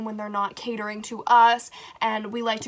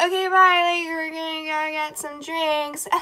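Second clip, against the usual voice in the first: breezy mom; 6 semitones higher, 3 dB louder than usual. Retold or imitated. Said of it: "So our moms are like".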